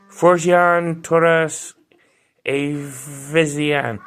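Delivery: very slow attempt at an unfamiliar name; drawn-out, halting stab at a difficult name; struggling pronunciation. Real, not acted. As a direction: sound out the foreign name slowly, unsure of it. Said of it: "definitely butcher that name".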